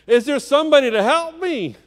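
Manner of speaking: high, pleading call; calling for help. A narrator's reading. Friend back in bed hears the missionary, calling for help. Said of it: "Murray Brown calling for help".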